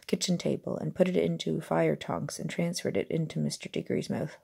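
ordinary delivery

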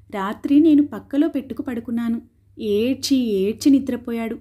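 usual speaking voice

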